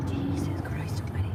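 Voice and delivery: a whispered oath at the traffic; exasperated whisper. Real, not acted. Driver whispering an oath at aggressive drivers, exasperated. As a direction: breathe it out under your breath in disbelief.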